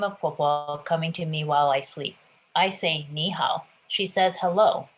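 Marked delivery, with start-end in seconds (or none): none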